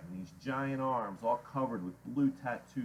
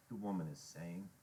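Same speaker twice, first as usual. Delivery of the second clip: ordinary delivery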